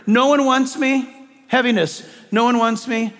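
usual speaking voice

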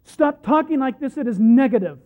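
loud, insistent scolding of a wayward son; parental rebuke. Acted. Peter pulling Jesus aside, loud parental rebuke. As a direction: tell him off like a parent. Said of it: "It's a parental rebuke".

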